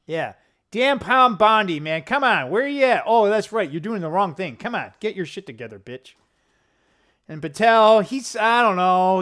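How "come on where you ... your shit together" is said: ordinary delivery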